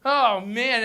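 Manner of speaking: usual speaking voice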